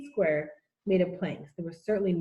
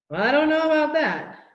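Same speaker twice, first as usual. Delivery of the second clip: doubtful cry; 8 semitones higher, 7 dB louder than usual. A narrator's reading. Omri doubtful of fish.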